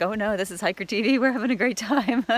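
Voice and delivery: high, loud imitation of gleeful onlookers; mock-excited hikers. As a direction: call it out like a delighted crowd being retold. Retelling other hikers' gleeful reply.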